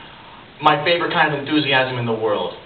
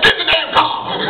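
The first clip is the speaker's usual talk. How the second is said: loud high yell through laughter; shouting; 5 dB louder than usual. Retold or imitated. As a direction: bark the order while laughing. Voicing Samuel L. Jackson line.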